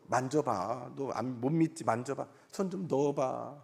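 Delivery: soft, coaxing voice of Jesus; gentle Jesus. Retold or imitated. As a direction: coax gently like Jesus inviting a doubter. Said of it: "예수님이 오셔서".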